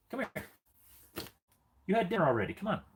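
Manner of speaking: high sing-song coaxing; pet talk. Acted. Owner calling his dog to the box, high sing-song. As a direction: sweet-talk the dog over.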